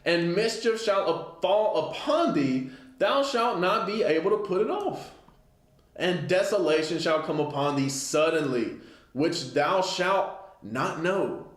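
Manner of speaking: a preacher reading judgment from the pulpit; scripture reading. Acted. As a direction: proclaim the verse slowly. Reads scripture aloud.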